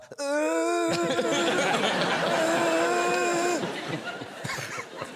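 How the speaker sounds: long high-pitched droning noise of a leaf blower; imitated motor whine, held for seconds; leaf blower drone. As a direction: hold a high mock-royal drone. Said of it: "he's like he's a leaf blower".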